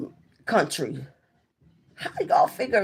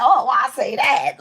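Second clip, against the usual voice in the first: higher, louder self-reproach; retold exasperation; 6 semitones higher, 6 dB louder than usual. After they made her talk, exasperated with herself. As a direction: kick yourself out loud, pitch raised. Retold regret, repeated more strongly.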